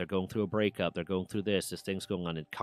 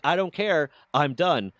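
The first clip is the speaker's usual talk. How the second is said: loud, higher, fed up retort; angry dismissal; 7 semitones higher, 7 dB louder, slower than usual. Retold or imitated. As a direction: slam the door on the talk. Shutting down the argument.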